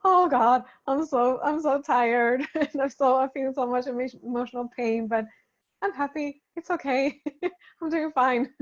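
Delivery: loud, laughing impression of a tired but happy listener; loud mock sigh of tiredness, laughing through it; laughing mock exasperation. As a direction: ham up an exhausted, giddy listener. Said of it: "It's like".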